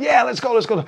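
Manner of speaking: over-the-top perky host; perky presenter. Retold or imitated. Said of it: "perky thing that's reading from a script".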